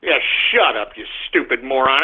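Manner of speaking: ordinary talk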